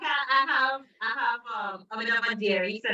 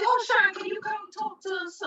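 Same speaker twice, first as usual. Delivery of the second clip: a much higher, eager voice calling out the invitation; 11 semitones higher than usual. Retold or imitated. To Sean, inviting her to talk, high and eager. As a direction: mimic people excitedly asking you to speak. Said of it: "they'll just appear and say".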